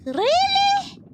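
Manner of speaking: "Really" with a rolled R, high and loud. Retold or imitated. Imitating the way she rolls her R's, rolled R. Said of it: "the way she roll her R's".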